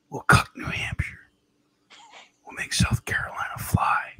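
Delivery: hushed, breathy conspiratorial whisper; Brandon. Acted. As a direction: confide a backroom deal in a halting whisper. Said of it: "that's a little Brandon for today".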